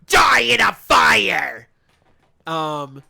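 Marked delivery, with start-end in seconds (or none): 0.1-1.7 slow, drawn-out yelled battle cry; slow, loud mock scream; retold scream. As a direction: bellow it like a move call. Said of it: "he would scream".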